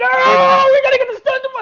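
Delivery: high, loud frantic shout at a coworker; mock frantic coworker. Mimicking a frantic coworker shaking a collar, high loud shout. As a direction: shriek it like a panicked coworker.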